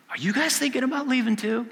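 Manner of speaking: Jesus asking the twelve, voice pitched right up; searching question. Acted. Jesus asks disciples, voiced high.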